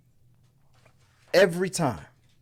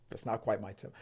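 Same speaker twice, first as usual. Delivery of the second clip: quiet Whiplash quote; 4 semitones lower, 12 dB quieter, faster than usual. Retold or imitated. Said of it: "what did he say on Whiplash".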